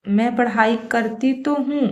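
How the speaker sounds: ordinary talk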